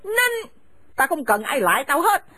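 harsh, contemptuous scolding of a daughter-in-law; bitter older woman driving her son's wife from the house; angry scolding mother. Acted. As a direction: spit the insults at her and order her out.

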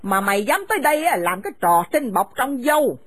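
harsh, contemptuous scolding of a daughter-in-law; bitter older woman driving her son's wife from the house; angry scolding mother. Acted. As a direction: spit the insults at her and order her out.

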